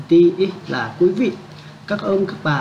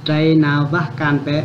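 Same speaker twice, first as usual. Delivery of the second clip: slow model reading; slower than usual. Shown as a demonstration. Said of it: "Vui, nhiều anh em".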